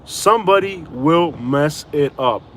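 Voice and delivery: drawn out word by word; slow emphatic repeat. Real, not acted. Warning viewers about Florida, slow and emphatic. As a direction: spell it out slowly, weighting every word.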